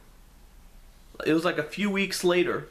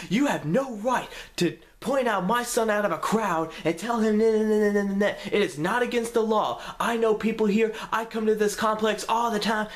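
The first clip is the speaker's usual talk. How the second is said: cussing him out; 6 semitones higher than usual. Retold or imitated. Mom ranting at security guard, high and heated. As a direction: tear into him for pointing out her son. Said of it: "going off on this guy and cussing him out".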